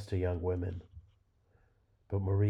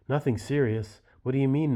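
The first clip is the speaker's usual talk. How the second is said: higher and louder, disbelieving; incredulous angry outburst; 6 semitones higher, 5 dB louder than usual. Acted. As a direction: throw her words back at her in disbelief. Husband reacts to son's violence.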